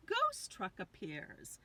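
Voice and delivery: ordinary delivery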